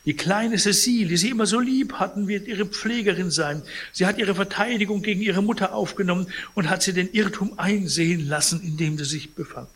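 lightly voiced, high and brisk messenger; character's visitor spiel. A narrator's reading. Visitor promises the sick man a nurse, lightly voiced, high. Visitor continues the relatives' message.

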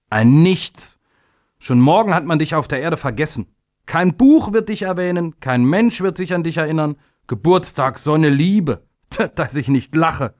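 high-pitched, mocking tirade; scornful outburst. A narrator's reading. Uncle mocking little day before everyone, scornful outburst. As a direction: rail at him with rising contempt. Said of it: "schrie der Onkel".

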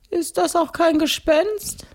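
very high-pitched ghost voice. Acted. Ghost asks about the shadow. (hohe Gespensterstimme)

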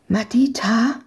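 hushed questioning call; cautious call. A narrator's reading. Searcher calls the girl through a hole in the fence, cautiously.

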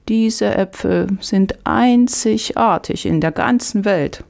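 slow, high market-woman voice; storybook vegetable woman praising her wares, drawn out; vegetable seller. A narrator's reading. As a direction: play the seller high and unhurried. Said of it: "antwortete die Gemüsefrau".

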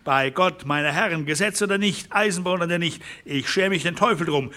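trotzig, kämpferisch; defiant rancher. Acted. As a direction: swear you won't be driven off. Defiant rancher vows resistance.

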